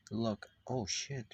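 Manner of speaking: ordinary delivery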